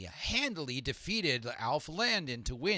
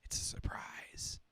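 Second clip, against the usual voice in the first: whisper; 10 dB quieter than usual. Acted. Teasing whisper to quiz players.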